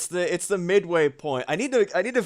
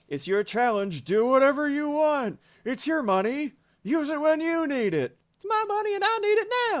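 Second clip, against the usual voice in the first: high cartoon voice, building faster; high squeaky greedy voice; mock cartoon voice; 13 semitones higher than usual. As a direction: go way up and rush the last line. Voicing chat vs selfish reply.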